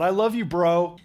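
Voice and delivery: a light hint of a casual dude voice; mock bro. Shown as a demonstration. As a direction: toss it off like a buddy.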